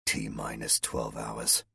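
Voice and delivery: low, soft and slow mumble; mumbled. A narrator's reading. Colonel alone with the news, low slow mumble. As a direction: murmur it to yourself. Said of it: "the colonel mumbled to himself".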